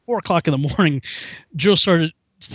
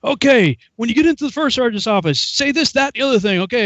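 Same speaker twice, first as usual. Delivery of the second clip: higher, forceful imitation of rattled-off orders; sergeant instructing; 7 semitones higher than usual. Sergeant briefs recruit outside office, brisk mock orders. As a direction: fire off instructions like a drill sergeant.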